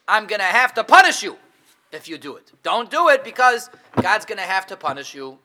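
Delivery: loud, hard-hit threat of punishment; angry God. Acted. Angry God warns people off the forbidden tree, loud threat. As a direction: thunder a warning as an angry God. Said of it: "It's just an angry God".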